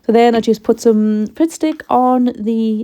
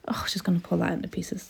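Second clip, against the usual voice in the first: hushed whisper to herself; whispering aside; 12 dB quieter than usual. Real, not acted. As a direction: whisper it under your breath. Dog raiding the bin.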